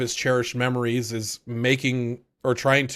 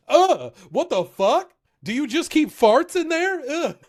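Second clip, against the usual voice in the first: disgusted squeal; 13 semitones higher than usual. Retold or imitated. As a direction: recoil from the smelly shoe, pitched way up. Engine sniffing the shoe.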